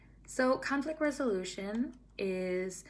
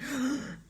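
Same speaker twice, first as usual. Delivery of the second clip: a quick startled gasp, pitched up; short alarmed intake of breath; sharp tense gasp; 7 semitones higher than usual. Acted. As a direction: gasp like you're all tight and tense. Mimics tense adult reacting.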